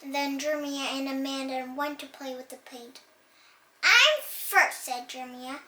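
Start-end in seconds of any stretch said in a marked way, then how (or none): 3.8-4.8 high, loud claim; bossy shout. Acted. Jeremiah claiming first turn, high bossy shout. Kids fighting over paint.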